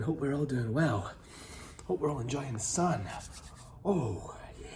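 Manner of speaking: usual speaking voice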